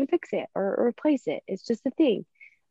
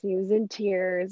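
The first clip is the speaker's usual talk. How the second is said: ordinary talk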